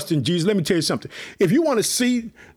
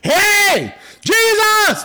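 high, loud shout of Jesus' name; calling out loud; 12 semitones higher, 5 dB louder, much slower than usual. Retold or imitated. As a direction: call him out across the crowd. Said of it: "He let everyone know".

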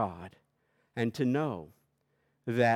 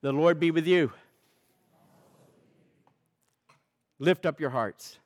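raised, sung-out liturgical call; 5 semitones higher, 6 dB louder than usual. Acted. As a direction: call the people to worship. Opens the Great Thanksgiving liturgy.

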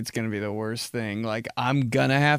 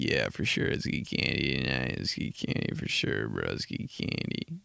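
much lower, mumbled and drowsy; sleepy stoner voice; 12 semitones lower, 7 dB quieter than usual. Retold or imitated. Roommate and I planning a candy night, sleepy low mumble. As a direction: talk like you just woke up. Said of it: "why me and him talk like that".

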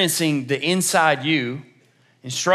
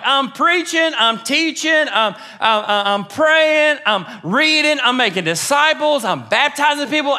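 high, insistent self-defense; hammed-up plea of the wronged; mock indignant complaint; 10 semitones higher, 4 dB louder than usual. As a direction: list it off in high-pitched exasperation. Acted indignant list to God.

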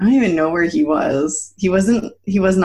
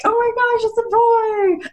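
stunned gasp. Retold or imitated. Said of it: "I was like".

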